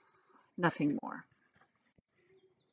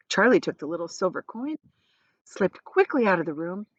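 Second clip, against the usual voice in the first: plain reading voice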